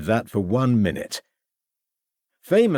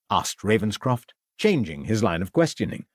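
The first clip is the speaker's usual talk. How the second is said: usual speaking voice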